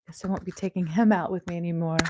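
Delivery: ordinary talk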